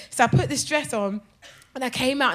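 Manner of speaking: ordinary talk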